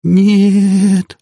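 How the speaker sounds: high, loud, drawn-out cry; moaning wail. A narrator's reading. Panicked woman who cannot help, moaning wail. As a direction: wail it out, holding the vowel. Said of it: "простонала она".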